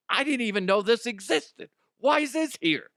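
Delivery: high, exasperated homeowner; mock bewilderment. Homeowner finding junk they forgot they had, mock bewilderment. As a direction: play baffled at your own clutter.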